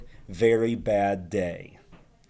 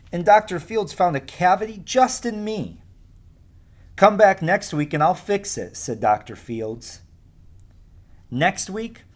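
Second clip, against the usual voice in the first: lively, higher storybook voice for the class; reading to children; 4 semitones higher than usual. Acted. Teacher reading Alexander to her class, lively storytelling voice. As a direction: read it like a picture book to kids. Teacher reads picture book aloud.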